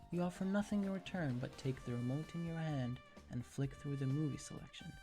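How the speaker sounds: usual speaking voice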